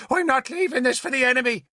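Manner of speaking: high, fast defiant shout; shouting at him. A narrator's reading. Princess shouts at the lord, defiant. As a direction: spit it out in a rush. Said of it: "she shouted at me".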